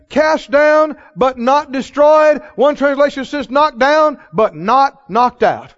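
loud, high, slow sermon cadence; fiery preaching. Acted. Preacher thunders scripture to the congregation. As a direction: proclaim it to the rafters.

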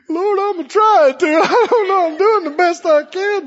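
high, hammed-up pleading; mock flustered believer. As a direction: protest to the Lord in feigned distress. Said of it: "Would you look at him and go".

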